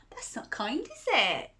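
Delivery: ordinary delivery, nothing marked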